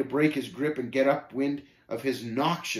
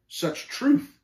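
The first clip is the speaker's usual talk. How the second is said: a high, louder comeback; sharp retort; 12 semitones higher, 4 dB louder than usual. A narrator's reading. Pilgrim answering Shame's insult, shooting back. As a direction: fire it straight back at him. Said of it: "I shot back".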